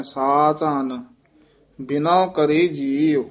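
ਸਾ ਧਨ drawn out very slowly, then a pause before ਬਿਨਾ; slow recitation demo. Shown as a demonstration. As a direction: recite ਸਾ ਧਨ slowly and hold the break. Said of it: "ਇੱਥੇ ਯਮਕ ਲਾ ਦੇਣੀ ਹੈ".

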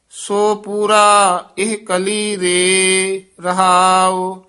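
scripture recitation. Real, not acted. Teacher reads Gurbani to the class, sustained recitation. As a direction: recite phrase by phrase, holding the endings.

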